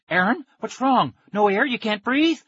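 high, loud panicked questions; alarmed. A narrator's reading. Kid fears his friend can't breathe, high panicked cry.

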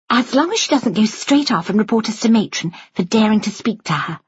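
high-pitched mock caution; playful student nurse agreeing; joking Millie.

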